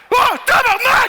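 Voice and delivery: high, loud tabarnak; mock shout. Gynecologist swearing at a patient, loud mock shout. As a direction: blurt it out. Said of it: "un gynécologue arriver faire".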